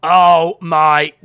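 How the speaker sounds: loud gasp of a shout; shocked shout. Real, not acted. Fan watching the knockout shouts in shock. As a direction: cry out at the brutal hit.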